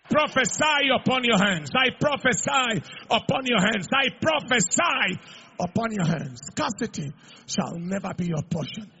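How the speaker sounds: loud, shouted prophetic declaration; full-force preaching. Acted. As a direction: thunder it over the congregation.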